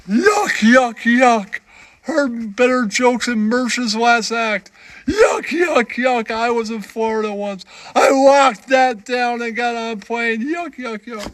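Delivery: high mocking yuck-yuck heckler; heckling puppet voice. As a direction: jeer like a snooty puppet critic.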